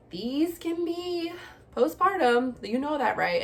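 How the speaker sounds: imitated friend, leading and careful; friend's gentle hint. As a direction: gently break it to her, as the friend did. Friend gently breaking it to her.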